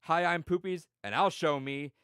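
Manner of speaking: mock TV-host greeting before a stunt; Poopies intro. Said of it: "He's like".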